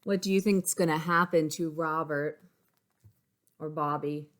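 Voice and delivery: usual speaking voice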